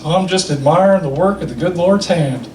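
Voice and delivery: lightly voiced Hank Hill, reverent; a hint of Hank Hill admiring the view; Hank Hill impression. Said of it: "sounds exactly like Hank Hill".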